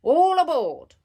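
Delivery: loud, high boarding cry; ringing ride-operator shout; announcer's call. Acted. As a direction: holler it out to the whole fairground. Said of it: "announced Miss Rabbit".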